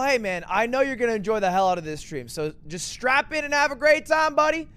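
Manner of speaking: ordinary delivery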